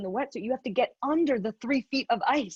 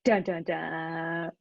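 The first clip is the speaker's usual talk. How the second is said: slow, lower mock dramatic "dun, dun, dun"; mock suspense; 6 semitones lower, much slower than usual. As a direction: drag out a spooky suspense sting.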